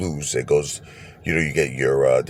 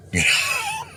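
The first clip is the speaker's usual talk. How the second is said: crying-shout laugh. Real, not acted. One point from jackpot.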